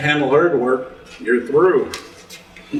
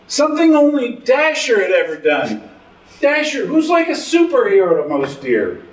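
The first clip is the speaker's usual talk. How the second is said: high-pitched, swelling hero worship; awed excitement; 8 semitones higher than usual. Acted. Father raving about Dasher, the superhero deer, in awed excitement. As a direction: marvel in a raised, animated pitch.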